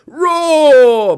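very loud, high sustained roar; bellowing roar. Acted. Furnace bellowing as the flames leap, long roar. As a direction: bellow it out as one long blast. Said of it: "bellowed the furnace".

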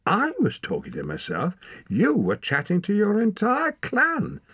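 starts level, rising to high and loud; indignant retort. A narrator's reading. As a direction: argue back, building to a shout. Bickering retort, rising to a shout.

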